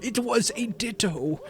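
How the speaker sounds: slow, eerie creepypasta narrator; spooky storyteller voice. Acted. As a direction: tell it like a ghost story by candlelight.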